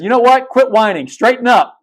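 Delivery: high, loud motherly scold telling him to shape up; a firm mother figure snapping him out of self-pity. Retold or imitated. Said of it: "somebody come along and say".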